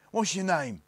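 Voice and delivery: high, loud, rough demand. A narrator's reading. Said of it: "said to Barney in the same voice".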